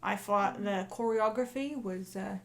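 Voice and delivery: put-on chatty Cockney accent; Cockney accent, in-character interview; Cockney lamplighter. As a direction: talk as a musing Cockney lamplighter.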